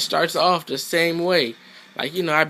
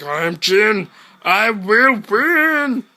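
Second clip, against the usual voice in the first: slow, louder, grandiose declaration; mock Jin boast; 6 semitones higher, 6 dB louder, much slower than usual. Gamer mocking opponent as Jin, slow grandiose boast. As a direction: stretch it out like a cheesy villain. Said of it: "He's like".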